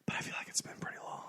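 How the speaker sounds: quick, very soft whisper; hushed, confiding aside. Real, not acted. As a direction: whisper it hurriedly under the talk. Whispered aside about episode length.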